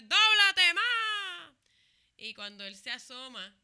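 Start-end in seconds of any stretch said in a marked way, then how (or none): 0.0-1.5 high, loud, drawn-out yell; mock catcall yell. Men at the beach yelling at her, loud mock catcall. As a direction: holler it like men catcalling from afar. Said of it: "me empezaron a gritar como que".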